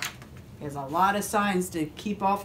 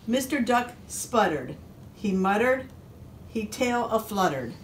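usual speaking voice

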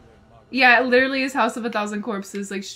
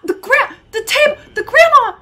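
high, loud broken stammer of alarm; loud, frantic bursts; panicked yelling; 9 semitones higher, 11 dB louder than usual. Real, not acted. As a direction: blurt it out in a panic. Panicking at the game scene.

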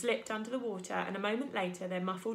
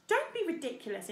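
raised, higher-pitched scolding interruption; higher, cutting-in objection; interrupting; 8 semitones higher than usual. A narrator's reading. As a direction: cut in as an exasperated beaver wife. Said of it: "interrupted Missus Beaver".

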